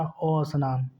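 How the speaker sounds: "अ स्नान" with a separate leading a; "अ स्नान" as a-snaan, the added vowel set apart; added initial vowel. Shown as a demonstration. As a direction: sound out the extra "अ" before "स्नान". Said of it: "उच्चारण अतिरिक्त किया है ना".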